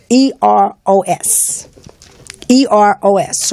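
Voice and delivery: each letter slow and separate; slow, deliberate spelling for listeners. Real, not acted. Spelling out word of the day.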